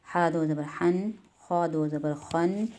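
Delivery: हा दो ज़बर हन, खौ दो ज़बर खन, drawn out slowly; slow, deliberate recitation; slow spelling drill. Shown as a demonstration. Teacher models the straight line for a child, slow. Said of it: "ऐसे ही करके ये सीधी लाइन".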